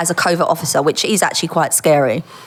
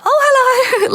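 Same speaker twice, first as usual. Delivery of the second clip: much higher, breathy-free coy hello; flustered flirty greeting; 4 dB louder, slower than usual. Retold or imitated. Greeting the handsome officer she fancied, coy and high. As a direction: play it coy and swooning for the handsome man.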